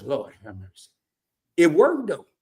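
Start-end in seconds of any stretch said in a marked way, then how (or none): 0.0-0.9 low, soft groan of dread; a hushed plea under his breath. Retold or imitated. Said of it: "I said".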